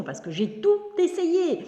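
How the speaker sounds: "tout" said high and slow, fed up; exasperated. Real, not acted. Exasperated about flies.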